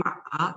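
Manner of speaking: MA dipping low, then rising; third tone. Shown as a demonstration. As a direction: let MA sag and come back up. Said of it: "change into the third tone".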